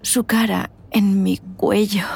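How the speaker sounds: slow breathless whisper. Acted. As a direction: whisper the fantasy slowly. Private erotic fantasy. (susurro lento y sensual)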